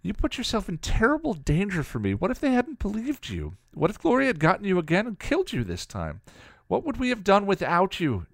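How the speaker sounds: high-pitched, distressed scolding; upset, near tears. A narrator's reading. Girl scolds her protector for risking himself, upset and near tears. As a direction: plead high and fast-rising, on the edge of crying. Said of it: "starting to cry".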